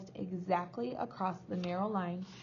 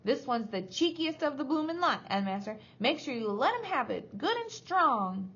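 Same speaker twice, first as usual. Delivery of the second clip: raised, spiteful screech; high voice egging on the headmaster; high-pitched screeching; 6 semitones higher than usual. A narrator's reading. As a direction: screech your goading from behind. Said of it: "dreadful high-pitched voice behind me screeching".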